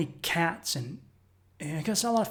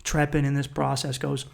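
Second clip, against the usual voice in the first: ordinary talk, nothing marked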